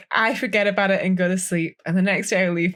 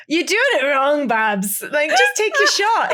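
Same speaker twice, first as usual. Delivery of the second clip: mock scolding; 6 semitones higher, 4 dB louder, slower than usual. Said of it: "So, like".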